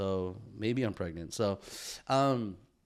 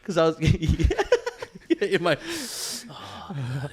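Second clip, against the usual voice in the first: laughing; 8 semitones higher, 7 dB louder, much slower than usual. Real, not acted. Laughing, embarrassed about that night.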